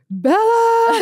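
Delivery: high call across the house. Retold or imitated. Retelling the husband calling their daughter, high call. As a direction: shout her name from another room. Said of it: "He's, like, calling her".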